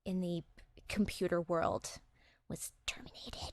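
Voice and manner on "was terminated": hushed reveal. Pretend. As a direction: whisper it, much softer. Spoiler revealed in mock hush.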